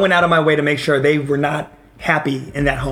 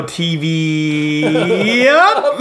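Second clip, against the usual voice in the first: laughing; 4 semitones higher, much slower than usual. Retold or imitated. Laughing aside to mom.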